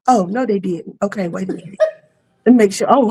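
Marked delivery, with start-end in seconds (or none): none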